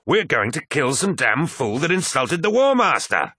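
high, forceful bellow; bellowing outrage. A narrator's reading. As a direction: proclaim it in blustering indignation. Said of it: "bellowed Abaddon".

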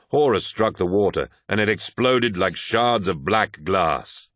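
ordinary delivery, nothing marked

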